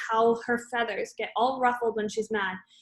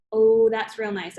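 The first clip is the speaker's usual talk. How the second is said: slow, louder retort with a drawn-out start; firing back; 7 dB louder, slower than usual. A narrator's reading. Friend firing back at a spiteful remark, sarcastic retort. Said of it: "I fire back".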